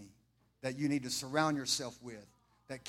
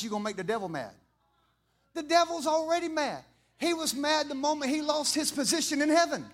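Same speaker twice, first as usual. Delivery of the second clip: preaching with force; 8 semitones higher, 7 dB louder than usual. Acted. Preaching about the devil.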